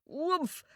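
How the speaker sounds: high, soft puff of exertion; small boy's straining shove noise; effort grunt. Acted. Boy shoves the ram, grunting with effort. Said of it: "and the boy shoved".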